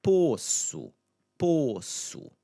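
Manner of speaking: "poço" as high, slow "POH-soo"; careful model "poço", closed stressed O. Shown as a demonstration. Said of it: "That same o sound".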